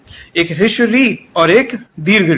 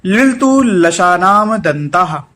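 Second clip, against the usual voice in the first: slow recitation; slower than usual. Shown as a demonstration. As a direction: slowly recite लिलतु लशानाम दंताः for students. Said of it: "अगला सूत्र है".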